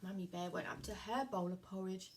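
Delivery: ordinary delivery, nothing marked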